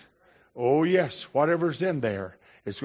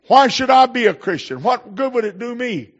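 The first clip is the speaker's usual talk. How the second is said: scoffing challenge; 10 semitones higher, 7 dB louder than usual. Retold or imitated.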